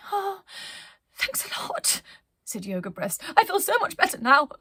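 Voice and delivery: high, quick, squeaky fake cheer; Yoga Breaths, fibbing. Yoga Breaths fibs to the crew, high and falsely cheerful. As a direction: pretend to be fine. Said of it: "he was fibbing".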